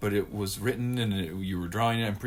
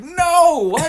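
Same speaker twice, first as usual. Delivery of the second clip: laughing shout; 13 dB louder than usual. Retold or imitated. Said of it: "I was like".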